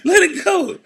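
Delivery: laughing plea. Retold or imitated. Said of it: "He's like".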